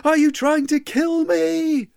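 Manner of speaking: high, loud terrified scream, slow and stretched; screaming weaver. Acted. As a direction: shriek it at the ghostly visitor. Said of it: "he screams at the top of his voice".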